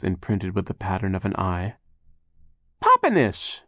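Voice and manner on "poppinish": very high, loud squeal; baby's shriek. A narrator's reading. Baby sister accuses the impostor, shrieking. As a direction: squeal it out like a little child. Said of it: "Sunny shrieked".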